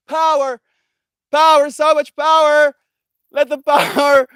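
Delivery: loud, high gleeful chant; excited. Real, not acted. Celebrating the power coming back, loud and high. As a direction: crow it out in triumph, over and over.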